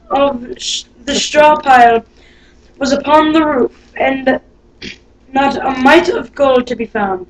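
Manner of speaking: plain reading voice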